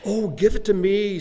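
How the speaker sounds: snatch at it eagerly; eager devil. A narrator's reading. Storyteller voicing the devil grabbing the paper, eager and loud. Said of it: "said the devil eagerly".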